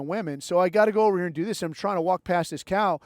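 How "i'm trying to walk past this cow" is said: normal talking voice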